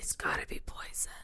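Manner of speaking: hushed, breathy warning; whispered warning. Retold or imitated.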